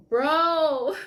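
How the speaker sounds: high, loud cry of "bro"; real high-pitched exclamation; overwhelmed outburst. Real, not acted. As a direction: burst out, overcome by what you read.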